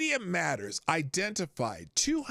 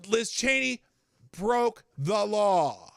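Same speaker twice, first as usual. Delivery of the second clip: louder and higher, slow and hammering; raised indignant declaration; worked-up emphatic rant; 7 semitones higher, 6 dB louder than usual. Real, not acted. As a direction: hammer home each point, indignant. Host repeating claim, worked up.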